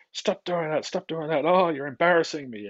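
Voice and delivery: mock a parent losing it in public; flustered parent yelling. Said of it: "becomes emotionally immature because it goes".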